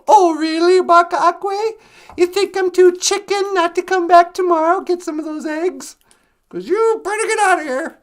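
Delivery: fox hollering back in a squabble; loud taunting retort. Acted. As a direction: throw the threat back, loud and cocky. Fox taunts hen back in quarrel.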